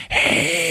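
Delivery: soft, high drawn-out creature cry; long, thin creature screech; imitated monster screech. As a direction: hold a quiet monster shriek. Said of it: "it's going".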